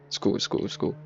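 hushed whisper to himself. Real, not acted. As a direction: breathe it out under your breath. Calming himself after a scare.

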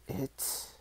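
put-on voiceless, soft old man's wheeze; breath-only wheezed query. A narrator's reading. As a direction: wheeze it out as a question. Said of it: "wheezed the old man".

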